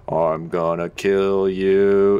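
slow, menacing monster threat. Pretend. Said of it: "I'm gonna start doing it".

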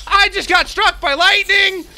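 high, loud outraged yell; startled shout. Real, not acted. Gamer to viewers after a lightning strike, yelling loud. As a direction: shout it out in shock.

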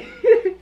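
sharp high giggle; high squeal laugh. Real, not acted. Reação animada. (risada aguda)

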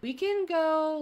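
ordinary talk, nothing marked

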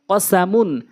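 short vowels, syllables run together; quick reading. Shown as a demonstration. Teacher showing how it must be read, quickly. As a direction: read it briskly as one word. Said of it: "দ্রুতটা উচ্চারণ করতে হবে".

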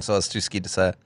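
normal talking voice

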